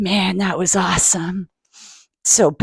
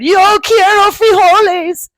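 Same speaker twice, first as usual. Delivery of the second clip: somber declaration; 11 semitones higher, 7 dB louder, slower than usual. Acted. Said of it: "In his most somber voice".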